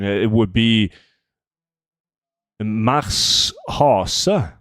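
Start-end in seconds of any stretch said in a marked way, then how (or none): 2.6-4.5 Mars Hase read the Norwegian way, as mahks HAH-suh; lightly Norwegian Mars Hase with a drawn-out Hase. Shown as a demonstration. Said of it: "If I was gonna read that in Norwegian".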